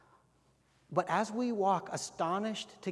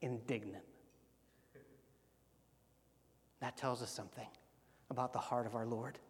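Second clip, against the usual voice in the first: quiet reflection; 6 semitones lower, 10 dB quieter than usual. Real, not acted.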